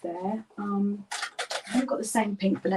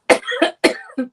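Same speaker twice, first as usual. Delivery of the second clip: loud, high hacking cough; harsh cough; 10 dB louder than usual. Real, not acted. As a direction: cough hard from heartburn. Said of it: "it's a terrible heartburn".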